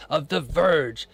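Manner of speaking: grand, over-enunciated mock proclamation; mock-formal read-aloud. Streamer reads an impersonator's message to viewers, mock-formal. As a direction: read it out like a pompous decree, stretching phrases.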